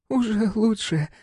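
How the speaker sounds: high, slow and timid; shaken young woman. A narrator's reading.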